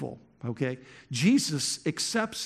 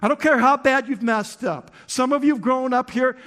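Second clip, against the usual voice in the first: preaching at full force, higher and louder; fiery preaching; 8 semitones higher, 6 dB louder than usual. Acted. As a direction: drive it home to the congregation. Preaching to congregation, raised voice.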